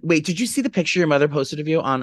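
ordinary talk, nothing marked

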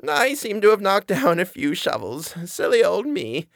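put-on high, sweet old lady voice; an old woman's high, quavering apology. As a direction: play a frail, kindly granny. Old lady character speaks.

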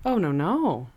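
ordinary delivery

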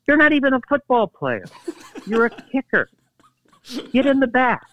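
high-pitched, incredulous imitation; mock indignant put-down. Teammate ordering the kicker to the back, mock indignation. Retold quarrel over airplane seat.

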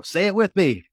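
loud, high-pitched urging; shouted invitation, like a coach; rallying call. Retold or imitated. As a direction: call out for them to join in. Rallying doubters in retold exchange.

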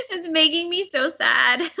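high laugh through the words. Real, not acted. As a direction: laugh while claiming sadness. Laughing over sad anime memory.

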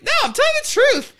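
put-on squeaky insistent child; high fast pleading kid.

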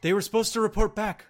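high, loud and fast; frantic, demanding answers; alarmed soldier. Acted. The guard pressing the survivor for answers, high and frantic.

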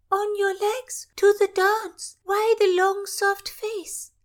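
very high, loud call to dance; a courtier pulling Constance onto the floor, very high and loud; boisterous courtier. Acted. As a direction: sweep her up with a hearty call. Siegfried pulls her to dance.

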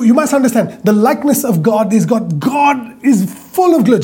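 normal talking voice

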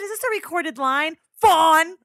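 loud, high pointed demand; angry challenge. Retold or imitated. Confronting the agent Fawn, loud angry challenge. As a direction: snap the name at her. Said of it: "I said".

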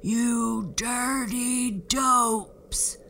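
very slow, loud giant boom. A narrator's reading. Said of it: "boomed the giant".

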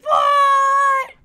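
a shouted, very high surprised What; shocked what. Retold or imitated. Retelling their shock at the email, shouted high What. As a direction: yell it out in disbelief.

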